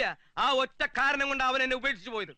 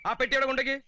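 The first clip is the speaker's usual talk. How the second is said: normal talking voice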